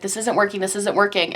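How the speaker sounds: normal talking voice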